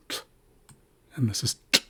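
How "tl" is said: tl popped sharply, unvoiced; ejective tl. Shown as a demonstration. Teacher contrasting plain and ejective sounds. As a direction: snap the tl out. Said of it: "this is tl".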